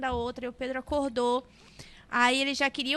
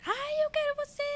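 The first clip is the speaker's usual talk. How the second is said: criança fazendo birra; 14 semitones higher, 4 dB quieter than usual. Retold or imitated. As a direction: imitate a small child wailing for a parent. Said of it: "Aí começou um, um pequeno caos". (choramingo agudo de criança)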